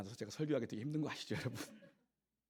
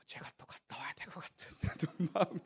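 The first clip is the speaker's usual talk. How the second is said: whispered with a chuckle; laughing whisper; 5 semitones higher than usual. Real, not acted. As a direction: say it half-laughing under breath. Joking about another India trip.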